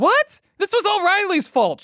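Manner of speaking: high, panicked protest; shocked. Acted. Fired, shocked and blaming.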